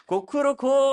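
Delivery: high mock cock-a-doodle-doo; rooster crow imitation. (కోడి కూత అనుకరణ)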